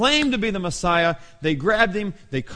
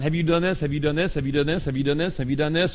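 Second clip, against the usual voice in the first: same question run on without a break, rising each time; repeated questioning; faster than usual. Retold or imitated. Accusers questioning Jesus over and over, relentless. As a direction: rattle off a relentless, repetitive interrogation. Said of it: "Over and over for twelve hours".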